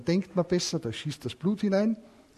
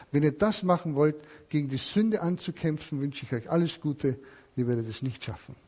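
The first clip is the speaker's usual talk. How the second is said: usual speaking voice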